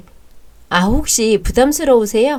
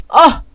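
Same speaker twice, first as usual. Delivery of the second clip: pained cry; 7 semitones higher, 9 dB louder than usual. Acted. Hit by brother.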